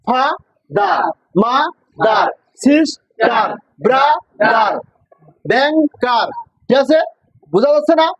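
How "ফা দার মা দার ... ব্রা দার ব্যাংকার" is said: each word chopped in two, like ফা, দার; syllable by syllable. Shown as a demonstration. Teacher demonstrates two-syllable words, each split in halves. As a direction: pause between the halves, as in মা, দার. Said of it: "যে সিলেবলের দুইটি অংশ আছে".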